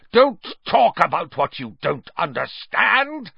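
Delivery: rant, barely in control; deranged. A narrator's reading. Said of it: "looking suddenly quite deranged".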